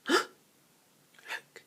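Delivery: breathless whispered look; gasped. A narrator's reading. Barney gasps to his friend on the roof, whispering. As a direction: hush and point in alarm. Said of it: "he gasped to his friend".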